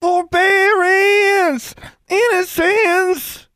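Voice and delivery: put-on high, drawn-out child yell; child shouting. A narrator's reading. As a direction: call out desperately like a little boy. Boy screaming for sisters.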